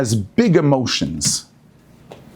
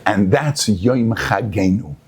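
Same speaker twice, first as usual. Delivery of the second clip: ordinary talk, nothing marked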